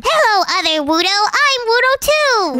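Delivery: normal talking voice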